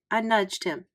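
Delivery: ordinary delivery, nothing marked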